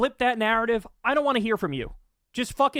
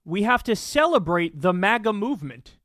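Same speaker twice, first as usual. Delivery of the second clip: ordinary talk, nothing marked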